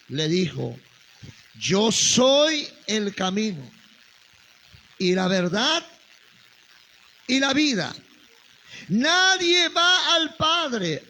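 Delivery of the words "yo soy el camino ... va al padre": higher, slow and solemn reading of Christ's words; preacher reading the Gospel aloud; raised scripture reading. Acted. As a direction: read it out like a sermon. Reads Jesus's reply.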